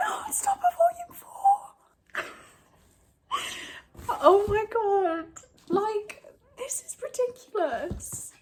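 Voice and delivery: on the verge of happy tears; overwhelmed, near tears. Real, not acted. Emotional at favourite book.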